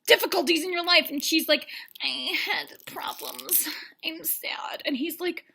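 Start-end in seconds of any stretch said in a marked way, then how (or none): 2.0-4.8 slow, hushed, woeful whisper; mock sad confession. Contestant confiding her troubles to the bachelor, mock sad. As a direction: quietly feign heartbreak, dragging the words.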